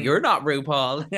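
high, loud, drawn-out put-down; slow, hammed-up indignant retort; mock annoyance. Contestant snubbing a judging friend, mock annoyed.

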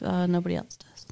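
soft unvoiced aside; whispered aside. Real, not acted. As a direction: breathe it out under the breath. Trailing aside.

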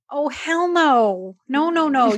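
mock-indignant refusal to the ticket guy; flat refusal. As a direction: shut the offer down with sass. Refusing two hundred dollars fee.

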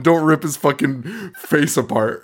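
ordinary talk

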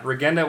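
Regenda with the G of get; hard G in Regenda. Shown as a demonstration. Co-host hunting for a new rhyme, hard G in Regenda. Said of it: "[name]'s saying hard G".

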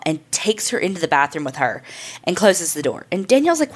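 usual speaking voice

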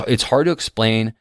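ordinary delivery

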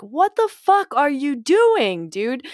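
high, slow disbelief; outraged. Retold or imitated. Voicing what she should have said, high and slow. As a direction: drag out the outrage, then drop.

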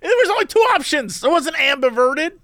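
1 indignant protest. Real, not acted. Playful insistence in argument.

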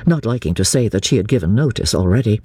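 ordinary delivery

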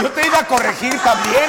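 high, loud playful correction; ribbing the guest for her slip; loud teasing. Real, not acted. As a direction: call her out with a laugh. Teasing correction amid laughter.

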